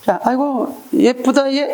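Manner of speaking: high, sing-song mock praise with a sneer; teacher acting a classmate's sly compliment, sarcastic and high. "pretty, huh" dripping with sarcasm. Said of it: "나를 비꼬는 말로 들렸다면".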